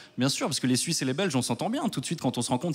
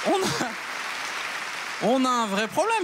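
mock outrage; 10 semitones higher, much slower than usual. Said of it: "mock outrage again".

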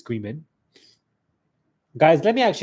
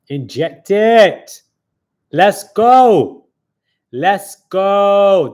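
drawn-out, much louder hype call; louder, higher hype yell; hype shout; 5 semitones higher, 11 dB louder, much slower than usual. Real, not acted. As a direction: shout it like a stream hype man. Hyping up the live chat.

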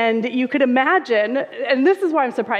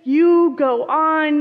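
yelled over the wind, higher and louder; yelling; 6 semitones higher, 4 dB louder, slower than usual. Acted. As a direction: shout it over wind and rain, waving off the rescuer. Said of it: "the man yells again".

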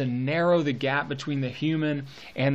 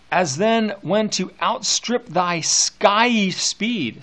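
slow, higher recited verse line; poem reading; 4 semitones higher, 4 dB louder, much slower than usual. Acted. Teacher reading the ode aloud to the class, slow and lifted. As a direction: lift it and stretch it like poetry.

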